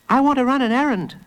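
put-on high, quick child voice; fast, high-pitched kid's plea; eager little boy. A narrator's reading. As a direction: pester father as a small boy. Small boy pleads with father.

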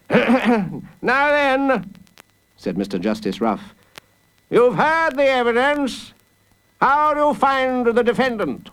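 high, formal courtroom voice; pompous judge. Acted. Judge asking the jury for a verdict, pompous. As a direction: address the jury grandly, pitched high.

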